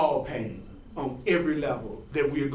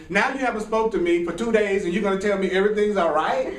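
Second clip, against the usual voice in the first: normal talking voice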